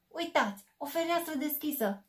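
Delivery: high squeaky mouse call; mouse squeak. Acted. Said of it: "chițăi șoarecele".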